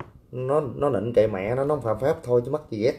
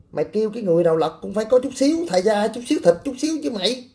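worked-up rant; 9 semitones higher, faster than usual. Real, not acted. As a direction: pitch shoots higher, words tumble fast. Heated rant defending Sơn Tây. (giọng hăng máu, cao và nhanh)

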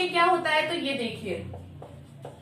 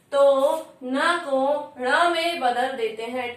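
slow emphatic rule; 3 semitones lower, slower than usual. Shown as a demonstration. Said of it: "teacher stresses the rule".

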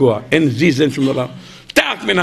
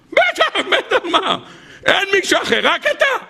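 very high, laughing yes; eager squeaky climber; 14 semitones higher than usual. Acted. The desperate climber eagerly assures God he believes. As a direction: gush your answer in a high, frantic voice.

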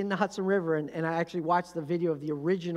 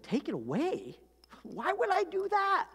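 incredulous Lord; 10 semitones higher than usual. Acted. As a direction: question the request as if it were absurd. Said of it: "the Lord's looking down and said".

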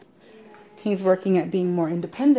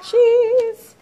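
announcing; 13 semitones higher, 7 dB louder than usual. Real, not acted. Announcing last salad ingredient.

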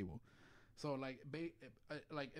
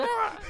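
put-on high laughing shriek of a falling kid; mock scream; 6 dB louder than usual. Imitating the kid tipping into the booth, shrieking. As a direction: yelp like the kid tipping over.

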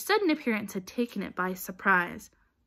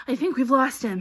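out of breath, with heavy puffs before speaking; pant, then push the words out; 3 semitones higher, 7 dB louder than usual. A narrator's reading. Boy catching his breath after a chase, panting. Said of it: "Harry panted".

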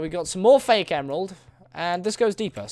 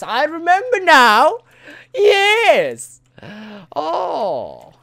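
put-on voice; 10 semitones higher, 7 dB louder, much slower than usual. Mock sage voice.

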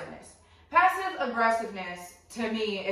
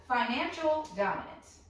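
ordinary talk

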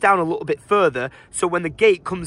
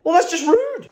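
offended; 13 semitones higher, 3 dB louder than usual. Real, not acted. Offended reply to robot.

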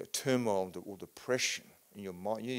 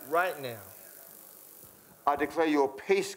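loud, high, commanding healing prayer; fervent preaching prayer; 3 semitones higher, 6 dB louder, slower than usual. Real, not acted. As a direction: declare it over him with full force. Healing prayer at full force.

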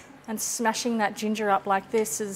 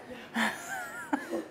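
high soft giggle; 9 dB quieter than usual. Real, not acted. Laughs at stinging eyes.

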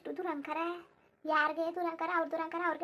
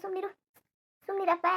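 ordinary talk

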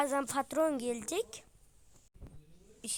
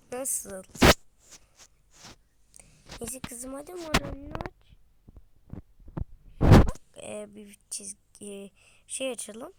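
usual speaking voice

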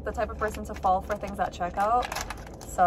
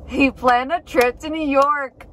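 stunned realization; 3 semitones higher, 7 dB louder, slower than usual. Retold or imitated. Realizing the surprise plan.

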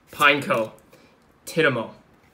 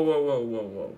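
ordinary talk

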